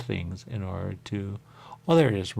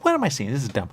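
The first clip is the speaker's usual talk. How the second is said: high, loud mock confusion; mock self voice; 6 semitones higher, 6 dB louder than usual. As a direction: squeal it in confusion. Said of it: "You go".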